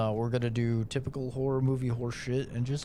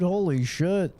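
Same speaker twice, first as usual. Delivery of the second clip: scared horror game streamer; 6 semitones higher, 6 dB louder, slower than usual. Real, not acted. Reacting to horror scene.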